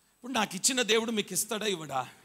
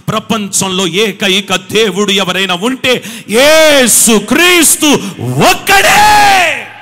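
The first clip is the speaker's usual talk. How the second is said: fiery preaching; 15 dB louder, slower than usual. Real, not acted. Pastor promising miracles to the congregation, fiery. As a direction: preach it, building to the top.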